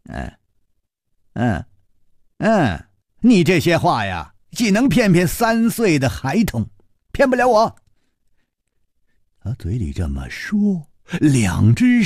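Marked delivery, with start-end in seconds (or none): 0.1-7.7 sneering dismissal, climbing high; cold sneer. Acted. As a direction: begin with scoffing grunts, then rise into a high, scornful rebuff. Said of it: "薛宝宝冷笑着说".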